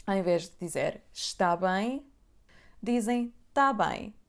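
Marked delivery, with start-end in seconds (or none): 3.5-4.1 tá with an open a, slower. Shown as a demonstration. Teacher modelling the colloquial tá bem. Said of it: "temos o som A aberto".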